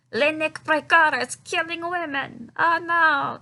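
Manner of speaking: loud, high mock French accent; terrible French accent. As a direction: do an awful French accent, slowly and loudly. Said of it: "worst French accent of all fucking time".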